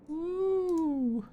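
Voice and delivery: high excited whoop. Real, not acted. GM cheers.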